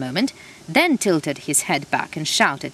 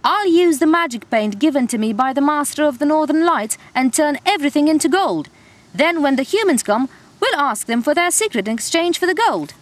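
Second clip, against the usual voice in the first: shouting; 8 semitones higher, 4 dB louder than usual. Acted. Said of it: "he shouted".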